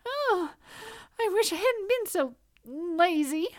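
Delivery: high tearful mouse voice; tiny squeaky critter. Acted. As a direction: squeak it out, close to tears.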